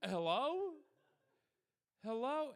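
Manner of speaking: high, loud panicked calling; alarmed hello. Pretend. Collector after the fake gunshot, loud high panic. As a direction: call into the silent line in alarm. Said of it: "the person on the other side being like".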